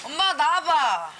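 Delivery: slow drawn-out call to mom; yelling across the room. Real, not acted. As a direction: shout for mom to come out. Calling mom to come out.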